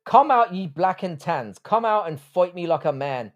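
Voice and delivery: hammed-up amateur Irish lilt; shitty Irish accent. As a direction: recite it in a rough mock Irish accent. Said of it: "in a shitty Irish accent".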